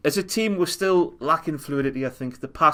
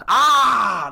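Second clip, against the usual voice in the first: high, loud mock crowd groan of dismay; imitated crowd groan; 10 semitones higher, 5 dB louder than usual. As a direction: do the whole stand's groan when it goes wrong. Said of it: "the roar that goes up when something bad happens".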